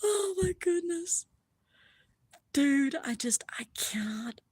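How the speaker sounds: real wonder, running out of words; speechless awe. Real, not acted. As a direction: start high, trail into a hushed whisper. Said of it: "I'm speechless".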